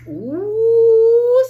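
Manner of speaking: a loud rising ooh of rapture; loud ecstatic ooh. Real, not acted. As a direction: let out a big high ooh, caught by the spirit. Spirit exclamation.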